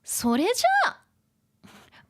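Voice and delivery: put-on high, loud, perky anime girl; Nijika-chan. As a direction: call it out in a bright character voice. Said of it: "Nijika-chan says".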